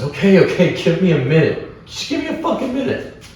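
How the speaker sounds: high, strained imitation of a cornered promoter; panicked pleading. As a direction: plead as if grabbed by the throat. Retold promoter held by the throat.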